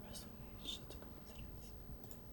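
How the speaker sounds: breathy unseen whisper from behind; ghostly whisper. Acted. Ghost whispering to a stranger on the bus. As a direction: whisper it like a voice from nowhere.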